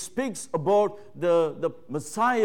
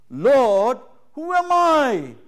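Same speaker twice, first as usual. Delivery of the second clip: put-on high, drawn-out pleading cry of the young Mary; a humble girl crying out to the Lord, high and slow; Mary's humble plea; 6 semitones higher, 7 dB louder, much slower than usual. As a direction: plead upward to God, small and overwhelmed. Preacher voicing Mary's humble plea.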